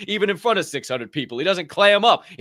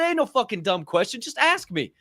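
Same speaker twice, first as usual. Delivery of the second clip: higher, firm insistence; insisting; 7 semitones higher than usual. Retold or imitated. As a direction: insist there are no dumb questions. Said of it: "I said".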